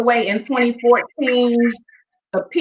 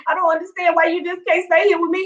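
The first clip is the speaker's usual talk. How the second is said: retold tearful plea; 7 semitones higher than usual. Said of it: "I was crying".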